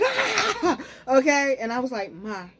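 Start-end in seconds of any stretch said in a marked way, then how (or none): 0.0-0.8 high laughing shriek; a short high panicked cry; mock panicked scream. To viewers, showing how she usually panics, a high shriek. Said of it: "I'm usually the person, I'll be like". 2.1-2.5 soft, level "Ma"; calm, quiet call. Retold or imitated. Waking her sleeping mother at the wheel, calm and quiet. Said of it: "And I said it so calmly".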